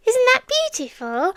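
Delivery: a high giggle breaking through the words; eerie delighted laugh. Acted. Creature delighting in the name she gave her captive, high giggle.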